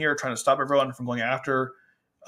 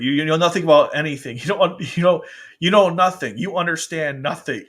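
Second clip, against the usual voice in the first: loud, higher-pitched angry outburst; imitated anger; 4 semitones higher, 6 dB louder than usual. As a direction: act out his furious rebuke, stumbling over repeats. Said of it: "He's, he's so angry".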